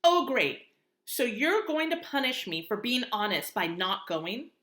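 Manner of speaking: loud bitter sarcastic outburst; angry sarcasm. Acted. Via snaps sarcastically at Mom for staying home. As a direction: throw it back at her, stung.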